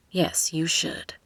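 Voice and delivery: slow, playful whisper; teasing whisper. A narrator's reading. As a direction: murmur it with a teasing grin. Playful reply before a peck.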